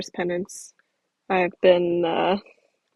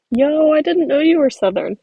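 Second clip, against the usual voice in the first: surprised; 7 semitones higher, 6 dB louder than usual. Real, not acted. Reacting to a chat reveal.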